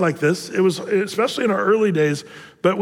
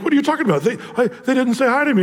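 high-pitched imitation of a put-out complainer; retold protest, pitched well up; mock indignant; 6 semitones higher, faster than usual. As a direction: play the baffled, offended neighbour. Retold neighbour's indignant reply.

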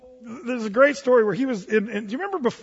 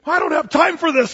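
hasty retreat; 7 semitones higher, 6 dB louder than usual. Retold or imitated. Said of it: "he says".

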